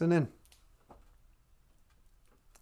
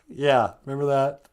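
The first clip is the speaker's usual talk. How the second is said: usual speaking voice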